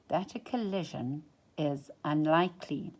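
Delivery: ordinary delivery, nothing marked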